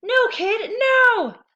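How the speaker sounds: very high, loud terrified scream; doomed tooth screaming. A narrator's reading. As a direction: shriek in terror.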